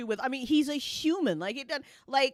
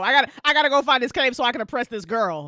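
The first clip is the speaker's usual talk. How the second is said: fast, louder mock urgency; mock rushing; 7 dB louder, faster than usual. Mocking the hero rushing to the cave, fast mock urgency. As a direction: hurry like a self-important hero.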